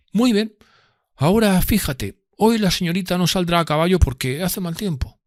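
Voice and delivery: high, lofty gentleman's voice; voicing Drumley as a haughty gentleman. A narrator's reading. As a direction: lord it over the room. Drumley orders waiter.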